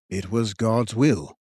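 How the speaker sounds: slow, grave royal voice; a lightly voiced ancient king, measured; solemn old king. A narrator's reading. King's voice in read story.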